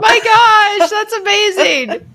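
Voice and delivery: high, gushing mock amazement; amazed gushing. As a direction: gush it high, like a starstruck reaction. Acting out own amazed reaction.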